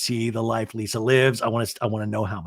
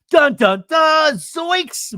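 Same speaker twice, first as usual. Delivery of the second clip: loud, climbing high fanfare; mock dramatic sting; 12 semitones higher, 6 dB louder, much slower than usual. Host scoring Whitney's reveal, loud high mock drama. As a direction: punch out a cartoonish shock reveal.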